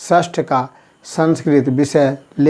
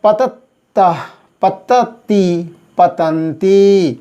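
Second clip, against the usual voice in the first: each option stretched out slowly; slow listing of options; 2 semitones higher, much slower than usual. Shown as a demonstration. Teacher listing word choices, slow and distinct. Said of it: "तो तीन शब्द है".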